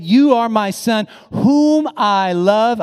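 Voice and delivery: loud, high, declaring God's words. Acted. Preacher quoting God to his congregation, God's voice. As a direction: proclaim it, drawing out the last words. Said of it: "God Almighty said".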